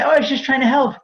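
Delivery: high-pitched mock innocent voice; mock excuse-making. Housing provider excusing themselves to investigators, mock innocent.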